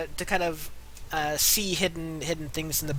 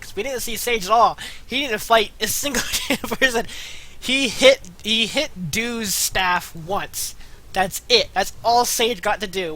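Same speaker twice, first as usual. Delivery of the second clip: excited; 5 semitones higher, 6 dB louder than usual. Real, not acted. Excited rant about Sage.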